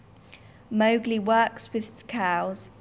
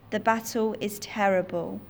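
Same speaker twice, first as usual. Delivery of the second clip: ordinary delivery, nothing marked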